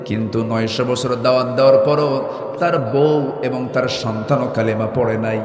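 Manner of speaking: full-force preaching. Acted. (জোরালো ওয়াজের সুর)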